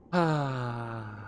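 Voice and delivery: long, falling voiced sigh; dejected sigh. Succubus flying back to the library, sighing dejectedly. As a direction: sigh it out, sinking in pitch. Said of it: "sighing the whole time".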